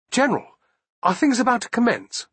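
high, chirpy English gentleman; bright eager Englishman asking; cheery Englishman. A narrator's reading. Cheery Englishman Fremantle asks.

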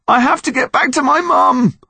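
desperate plea. Acted. Boy begs desperately.

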